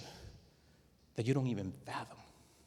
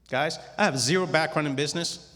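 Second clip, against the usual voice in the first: ordinary talk, nothing marked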